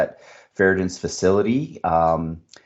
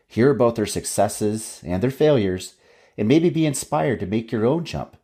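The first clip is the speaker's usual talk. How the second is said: upbeat announcer read; podcast intro read; 4 semitones higher than usual. Acted.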